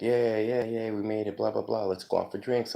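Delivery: lightly voiced buddy, upbeat and high; Irish guy. Irish runner inviting him for drinks after marathon, lightly voiced. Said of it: "the Irish guy was like".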